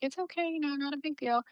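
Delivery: high, soft soothing voice; retold reassurance. Staffer to mom of a screaming child, soft high reassurance. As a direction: gently play it down, light and hushed.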